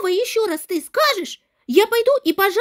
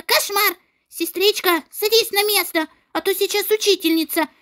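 ordinary talk, nothing marked

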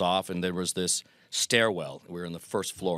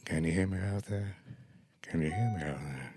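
softer, mumbled into the mic; mumbling old man; 2 semitones lower, 6 dB quieter than usual. Retold or imitated. Old Groucho at the podium mumbling to the crowd, soft and flat. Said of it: "and he's mumbling".

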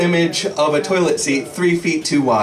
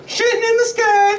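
bird voice. Acted. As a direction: answer as the bird in a shrill-high cry. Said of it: "It says".

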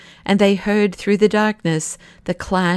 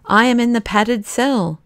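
ordinary delivery, nothing marked

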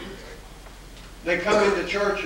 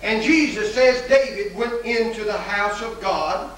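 preaching; 4 semitones higher than usual. Acted. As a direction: preach it out to the congregation. Preacher builds to full force.

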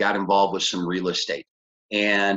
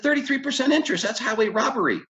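high, rapid-starting protest; acted indignant disbelief; faster than usual. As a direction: re-enact scandalized outrage to the mentor.

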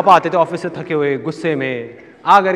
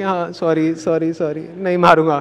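sheepish apology; slower than usual. Retold or imitated. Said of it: "नीचे मुंह करके".

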